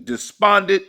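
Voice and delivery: normal talking voice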